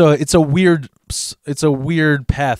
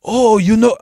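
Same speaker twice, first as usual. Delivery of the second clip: put-on high-pitched, Cheech-style Mexican accent; Mexican accent impression; 8 semitones higher than usual. Thief starts his excuse, mock Mexican accent. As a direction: imitate an older man's Mexican accent. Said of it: "that typical Cheech Mexican accent".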